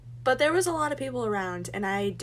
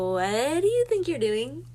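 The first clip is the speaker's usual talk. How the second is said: a louder, higher "what do you think you're doing?" with a rising end; indignant demand; 5 semitones higher, 5 dB louder than usual. Retold or imitated. Catching her date pocketing her card, indignant and louder. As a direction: challenge him, startled and offended.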